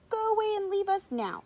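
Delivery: high-pitched haughty dismissal; dismissive. A narrator's reading. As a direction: send them off in a high voice. Giraffes dismiss the cow.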